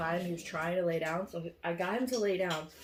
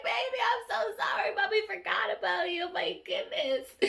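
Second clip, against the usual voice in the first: put-on high baby talk to dog; 13 semitones higher, 5 dB louder than usual. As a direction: gush over the dog like a sorry mom. Apologising to the dog.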